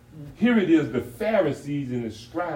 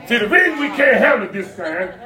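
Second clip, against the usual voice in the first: raised, punched sermon phrases; emphatic preaching; 10 semitones higher, 11 dB louder than usual. Acted. Pastor urging the church to heed God, preaching. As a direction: preach it hard, pausing between phrases.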